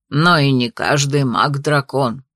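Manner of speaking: put-on low, slow old man lecturing; a doddering grandfather teaching. A narrator's reading. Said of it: "поучал внука дряхлый, но всё ещё бодрый дедушка".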